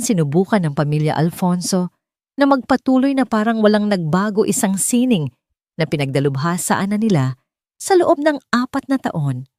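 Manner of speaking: plain reading voice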